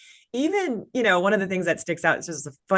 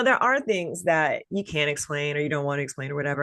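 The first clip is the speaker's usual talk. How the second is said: usual speaking voice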